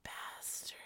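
very soft, voiceless whisper; whispered insult. Real, not acted. Speaker cursing to herself after laughing, whispered. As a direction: whisper it under your breath.

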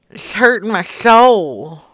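restrained mock-hurt grumble; mock wounded. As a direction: lament it without raising the pitch. Complains of silence, first take.

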